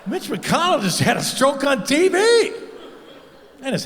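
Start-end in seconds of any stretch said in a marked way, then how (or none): 0.1-2.5 high, slow, laughing disbelief; stunned laughing what-the-fuck; shocked disbelief. Retold or imitated. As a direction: gasp it out like you can't believe your eyes. Stand-up act-out of shocked reaction.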